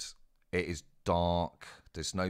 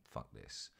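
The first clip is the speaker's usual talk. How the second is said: muttered give-up; 11 semitones lower, 17 dB quieter than usual. Retold or imitated. Giving up on the guard.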